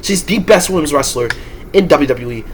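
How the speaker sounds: ordinary delivery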